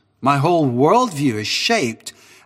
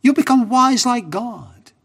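tempting serpent; 10 semitones higher, 3 dB louder than usual. Retold or imitated. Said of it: "He says".

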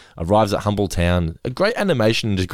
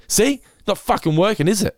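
high, indignant mock complaint; angry retold; 12 semitones higher than usual. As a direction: imitate an angry customer proving his point. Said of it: "so angry".

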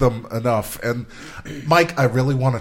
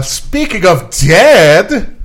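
slow, louder, drawn-out segue; dramatic segue; 8 semitones higher, 7 dB louder, much slower than usual. Acted. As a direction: stretch it out like a big reveal. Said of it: "I don't know where I'm going with this".

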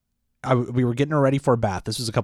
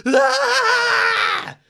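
long, very high mock baby wail; imitated baby scream; 6 dB louder than usual. Dad imitating his newborn from the other room, shrieking wail. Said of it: "all of a sudden I hear".

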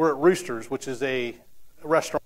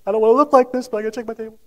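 retold crying plea, higher and louder, half-acted; tearful pleading; 7 semitones higher, 10 dB louder, faster than usual. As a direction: imitate a waitress fighting back tears. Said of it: "she cried and cried".

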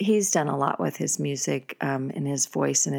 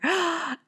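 gasp; 12 semitones higher than usual. Retold or imitated. I couldn't breathe.

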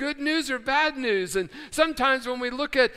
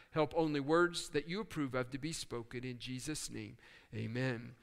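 soft, low, hushed prayer voice; 6 semitones lower, 7 dB quieter than usual. Acted. Pastor prays softly to the Father before the message. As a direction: pray quietly and humbly to the Father.

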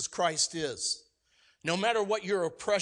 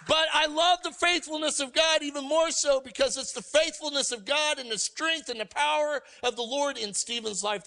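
preaching at full force; 7 semitones higher than usual. Acted. Pastor rallying the church about Stephen, fervent preaching. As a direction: drive the congregation to a triumphant peak.

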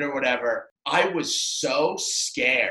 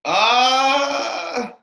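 acted stunned stall; 10 semitones higher, 5 dB louder than usual. Acting out his stunned response.

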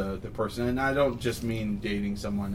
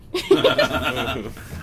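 giggle; 4 dB louder than usual. Real, not acted. Laughing at his own joke.

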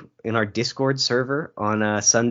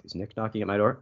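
ordinary delivery

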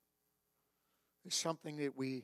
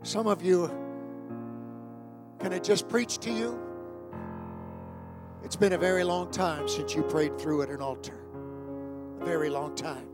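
altar call preaching; 2 semitones higher, 6 dB louder than usual. Acted.

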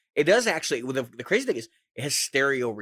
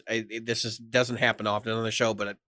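ordinary delivery

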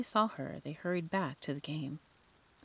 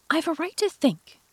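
higher, louder sharp retort; 11 semitones higher, 8 dB louder than usual. A narrator's reading. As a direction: snap back indignantly. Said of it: "said Alice sharply".

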